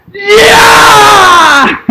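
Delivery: screaming high and loud as the tying goal goes in; ecstatic goal yell. Real, not acted. Fan roaring at the screen for the tying goal, ecstatic yell. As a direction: let it rip, full-throated goal call.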